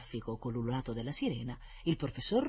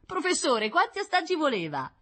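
put-on excited boy; 6 dB louder than usual. Boy excited, sees crowd.

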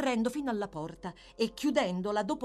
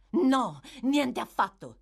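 loud, high denial; emphatic agitated rebuttal; agitated after a fight; 5 semitones higher, 6 dB louder, slower than usual. A narrator's reading. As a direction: protest heatedly, fresh from a struggle. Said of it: "pallida e ansimante dopo la zuffa".

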